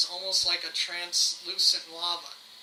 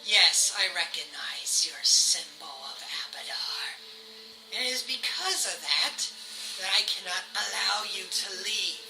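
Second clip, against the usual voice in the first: whispering villain; 3 semitones higher, 7 dB louder than usual. Acted. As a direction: whisper building to a loud threat. GM voices queen threatening party.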